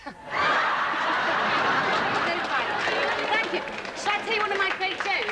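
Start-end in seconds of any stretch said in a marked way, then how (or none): 0.2-3.5 loud unvoiced laughing fit; big laugh. Real, not acted. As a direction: burst out laughing. Laughs at own impression.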